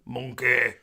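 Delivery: lightly imitated comedian's catchphrase; a hint of a comic's drawn-out name call; Johnny Vegas impression. Answering what the toy's name is, lightly mimicked comedian. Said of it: "I think it was Johnny Vegas".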